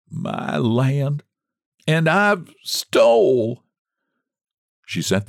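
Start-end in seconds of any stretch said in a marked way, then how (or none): 0.1-3.6 breathless, shocked gasp, slow at the end. A narrator's reading. Margaret gasping at the money in her lap. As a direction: gasp it out in dismay. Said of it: "gasped Margaret".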